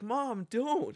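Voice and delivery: high-pitched imitation of a protesting kid; mock pleading kid. Kid pleading with mother, retold.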